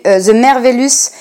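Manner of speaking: 'The Merveillous' in English said with a heavy French accent. Shown as a demonstration. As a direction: try the English title, self-mockingly French. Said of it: "avec mon bel accent".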